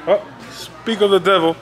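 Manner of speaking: usual speaking voice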